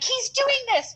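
high, indignant protest; defensive kid. Retold or imitated. Girl defending herself to the teacher, high and indignant. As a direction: whine it like a blamed child. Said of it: "and I'm like".

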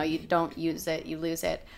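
normal talking voice

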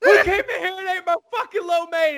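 hammed-up fury over lost lo mein; mock furious Tony. Tony finds his lo mein gone, strained furious yell. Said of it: "He's like".

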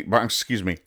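usual speaking voice

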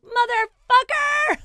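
high, loud, drawn-out curse; hammed-up yell of outrage; upset outburst. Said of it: "I was so upset".

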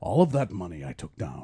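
low, gruff action-hero growl; a deep Liam Neeson line through a laugh. Pretend. Doing Liam Neeson for friends, low and gruff. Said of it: "say this in a Liam Neeson accent".

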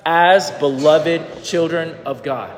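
slow, deliberate repeat; slow reading aloud again. Shown as a demonstration. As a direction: read it word by word. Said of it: "Can you say that one more time?".

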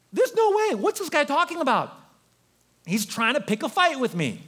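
a ranting king, pitched high; livid king. Acted. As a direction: play the furious king who's been insulted. King livid, acting out outrage.

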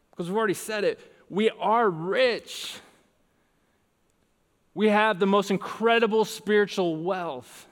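high, stretched-out sermon proclamation; forceful pulpit voice, pitched well up; emphatic preaching. Real, not acted. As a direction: proclaim it to the whole congregation. Preaches triumphantly at full force.

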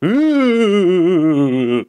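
long high "ooh" sliding slowly down; drawn-out mock ghost moan; spooky ghost wail. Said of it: "they hear a spooky voice".

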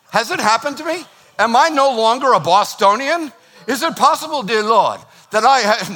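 high, hammed-up Southern alarm; mock Southern accent. Bostonian preacher joking he has turned Southern, mock-dramatic Southern accent. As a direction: plead in exaggerated Southern distress. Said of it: "I don't know if I'm doing that accent".

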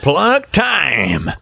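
high, drawn-out announcement in an American impression accent; swooping, stretched American-accent call; Trump. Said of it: "it's 'cause I'm channeling Trump".

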